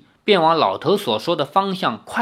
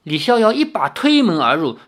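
plain reading voice

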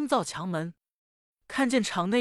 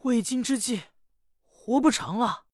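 put-on higher, alarmed cry of a girl in trouble; panicked young lady; 5 semitones higher than usual. Young lady finds the red garment gone, panicked and higher. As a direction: gasp it out as disaster strikes.

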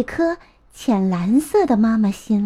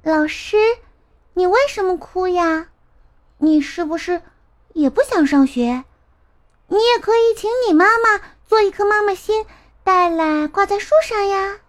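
put-on high, innocent little boy voice, partly quick; innocent child asking; 8 semitones higher than usual. 小苹果 asking the tearful teacher why she cries, high innocent child voice. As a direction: ask like a curious, earnest kid. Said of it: "小声地问".

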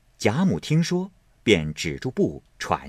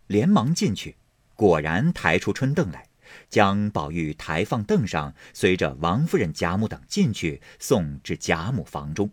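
ordinary delivery, nothing marked

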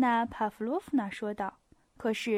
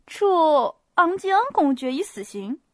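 higher, louder eager declaration; eager outburst; 4 semitones higher, 7 dB louder than usual. A narrator's reading. Pierre eagerly resuming his talk, excited. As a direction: jump back in with zeal. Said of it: "皮埃尔又兴冲冲地谈起话来了".

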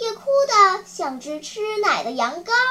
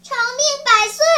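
high, loud, piping well-wish; ringing high-pitched blessing; puppet's cheery blessing; 7 semitones higher, 5 dB louder than usual. Acted. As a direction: call it out high like a little puppet.

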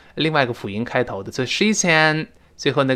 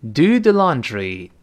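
慢速重复 do the laundry; slow model reading; slower than usual. Shown as a demonstration. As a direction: do the laundry drawn out slowly for repetition. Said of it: "再来一遍". (放慢示范 do the laundry)

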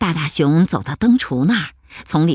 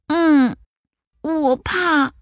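high small cub voice; timid little bear; 10 semitones higher, much slower than usual. Acted. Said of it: "little bear speaks".

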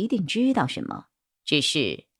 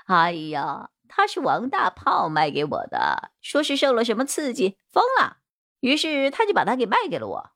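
higher, swooping character voice; cowed bald suspect; 6 semitones higher than usual. Acted. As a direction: spill it all in a pitched-up suspect voice. (光头嫌犯尖着嗓子老实交代)

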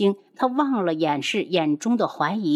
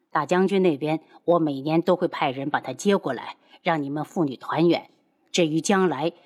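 much lower, calm promise; low reassuring lover; 4 semitones lower than usual. Acted. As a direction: reassure her, low and steady.

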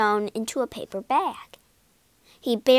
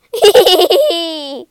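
loud falling chuckle of delight; a short burst of joyful laughter; happy laugh; 6 semitones higher, 12 dB louder than usual. A narrator's reading. Mervyn laughing with delight at the party idea.

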